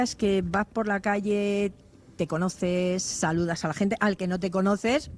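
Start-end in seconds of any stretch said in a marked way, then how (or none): none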